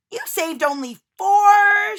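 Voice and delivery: high, loud outraged shout. A narrator's reading. Lucy Woolley shouts at Simon over the tarts. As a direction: yell it at the baker. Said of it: "she shouted".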